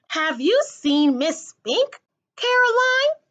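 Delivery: put-on high, slow, fluttery old lady voice; fussy old actress. A narrator's reading. Miss Forcible calling out to Coraline through the fog. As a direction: call out sweetly, rising high on the name.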